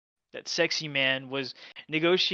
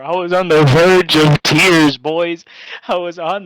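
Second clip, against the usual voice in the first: much louder, stressed outcry; loud exclaim; 16 dB louder than usual. Real, not acted. Telling the boys how bad it got, a loud outcry. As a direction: belt it out like a big confession. Emphatic retelling to listener.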